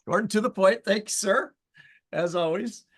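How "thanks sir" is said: ordinary delivery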